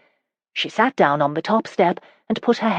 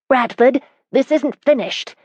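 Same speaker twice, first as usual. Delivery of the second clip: raised, high call down the hall; calling after; 8 semitones higher, 4 dB louder than usual. A narrator's reading. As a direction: call out after him, higher and louder. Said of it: "Carolyn called after him".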